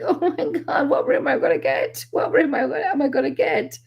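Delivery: high-pitched pretend panic; lightly hammed-up anxious wondering; mock fretting. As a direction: play up worrying over which room. Retold fretting over castle room.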